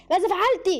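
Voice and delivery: high, insistent repeated question; angry demand. A narrator's reading. Repeated demand while choking her.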